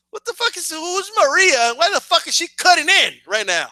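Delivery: high-pitched, incredulous complaint; indignant viewer, voice pushed up high; baffled outrage. Retold or imitated. Viewer annoyed at the friend cutting in, high indignant disbelief.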